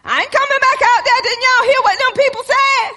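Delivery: high, fast, loud panicked protest; frightened refusal. Retold or imitated. A frightened person refusing to go back out, high and fast. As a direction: blurt it out like someone scared stiff.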